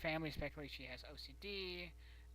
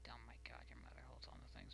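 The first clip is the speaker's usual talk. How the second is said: low hushed whisper; conspiratorial whisper about the mother; 6 semitones lower, 15 dB quieter, much faster than usual. Real, not acted. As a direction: whisper it like a secret.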